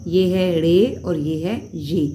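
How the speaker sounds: रे and ये said slowly and clearly; slow recap. Shown as a demonstration. Teacher repeating the right names slowly. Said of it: "इसी तरह ये सिर्फ ये है".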